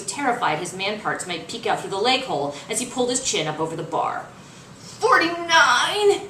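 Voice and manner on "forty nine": slow, strained, higher count; grunting with fatigue. Acted. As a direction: squeeze it out mid pull-up. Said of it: "his voice grunting with fatigue".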